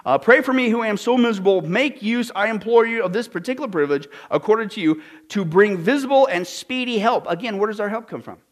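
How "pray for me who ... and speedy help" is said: higher, emphatic recital of a prayer; declaimed prayer reading. Acted. Preacher quoting a saint prayer to congregation, declaimed. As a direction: read it out like a grand plea.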